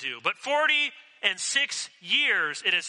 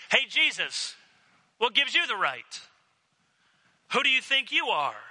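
higher, sharp, affronted voice; mocking indignation; 7 semitones higher than usual. Hard-hearted leaders rounding on Jesus, indignant. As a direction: snap back at him in offended disbelief.

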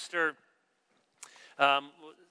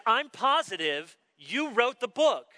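high, emphatic mock certainty; insistent pastor; 6 semitones higher, 4 dB louder than usual. Pastor pressing the author about the book, retold as stubbornly insistent. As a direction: imitate someone who will not take no for an answer. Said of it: "he would not take no for an answer".

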